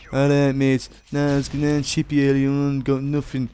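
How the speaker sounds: lightly put-on English mate's accent; English lad accent. Acting out taunts from English people.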